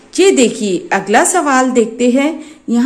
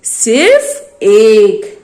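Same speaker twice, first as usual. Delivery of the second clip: "सिर्फ एक" drawn out, louder, stress on "सिर्फ"; slow stressed answer; 2 semitones lower, 4 dB louder, much slower than usual. Shown as a demonstration. Teacher defining one for children, slow and emphatic. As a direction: lean hard and slow into "सिर्फ एक". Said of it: "एक का मतलब होता है".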